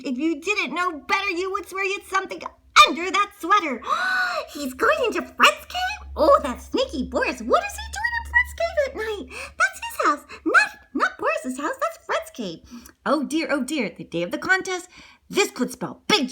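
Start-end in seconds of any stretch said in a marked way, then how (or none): 3.9-4.5 high sudden gasp; sharp intake of surprise; shocked gasp. Acted. Narrator shocked by sneaky Boris. 4.5-12.6 high-pitched scandalised storyteller; high, astonished narration; shocked disbelief. A narrator's reading. Shocked at Boris sneaking in.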